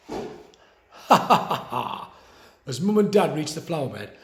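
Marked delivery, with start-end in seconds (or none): 0.1-2.1 mock laughter. A narrator's reading. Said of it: "joined in with their laughter".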